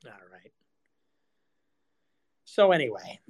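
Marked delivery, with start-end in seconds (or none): 0.1-0.5 soft, unvoiced aside; whisper. Real, not acted. Streamer muttering to himself, whispered. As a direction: breathe it almost silently.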